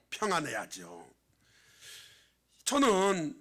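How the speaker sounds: ordinary delivery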